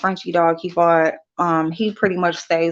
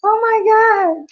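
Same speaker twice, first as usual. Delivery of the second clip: high, slow exclaim; 14 semitones higher, 3 dB louder, slower than usual. Real, not acted. Exasperated about grandkids.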